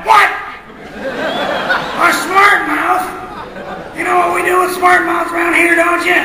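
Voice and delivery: high, loud, menacing yell; furious shout. Acted. Angry father threatening the sassy kid, shouting. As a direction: threaten the smart mouth at full volume. George is mad now.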